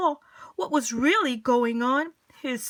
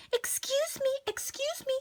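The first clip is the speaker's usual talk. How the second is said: much higher, softer worried Mrs Prim; fretful little plea for attention; timid high voice; high, soft anxious lady; 14 semitones higher, 5 dB quieter than usual. Acted.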